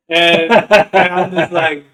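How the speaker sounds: high and loud, spoken through laughter; can barely get the words out for laughing. Real, not acted. Telling a friend about a meme, laughing.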